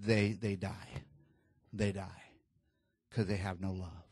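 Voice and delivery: low, hushed admission, with long pauses; quietly breaking terrible news; grave, sorrowful. Retold or imitated. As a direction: let the voice sink soft and heavy. Nurse reveals the babies' fate.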